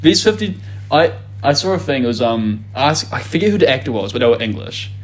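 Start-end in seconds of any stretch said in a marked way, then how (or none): none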